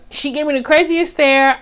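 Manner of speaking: ordinary talk, nothing marked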